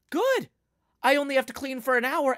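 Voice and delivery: bright sarcastic relief; sarcastic good. Retold or imitated. Telling parents she only has to clean an hour, sarcastic. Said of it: "And then I'll say".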